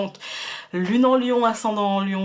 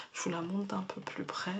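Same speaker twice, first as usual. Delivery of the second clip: whisper; 13 dB quieter than usual. Real, not acted. Leans in to show the card.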